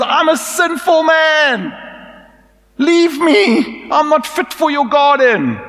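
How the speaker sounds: high, loud, pleading cry; a broken man begging to be left; desperate Peter. Acted. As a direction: Peter on his knees cries out in shame. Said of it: "He says".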